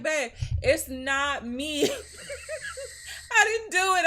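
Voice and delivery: pleading through laughter, pitch climbing high; laughing denial. Retold or imitated. To her husband, denying the smell while laughing. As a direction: protest your innocence while cracking up.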